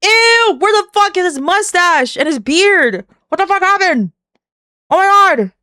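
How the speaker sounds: high-pitched, appalled outburst; shocked disgust. Real, not acted. Reacting to a man without his mustache and beard, shocked. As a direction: cry out in horrified disbelief. Sudden shock at shaved face.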